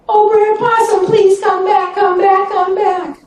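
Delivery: high, pleading call after him; calling snake. Acted. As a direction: beg him to come back from afar. Snake calls him back.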